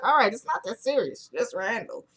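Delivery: mock nonchalance, tailing off softly; unfazed. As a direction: imitate a man playing it down. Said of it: "did y'all get that vibe".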